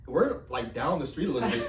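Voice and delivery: ordinary delivery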